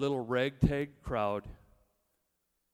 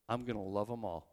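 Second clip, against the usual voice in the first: tender resolve; 5 semitones lower, 6 dB quieter than usual. Acted. Jesus reveals his plan softly.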